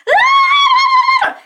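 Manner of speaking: high, loud mock scream. Little girls in the party react to loose lizard, screaming. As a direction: imitate little girls shrieking. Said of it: "start screaming and yelling".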